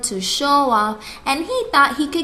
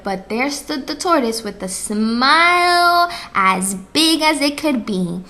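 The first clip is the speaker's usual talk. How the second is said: plain reading voice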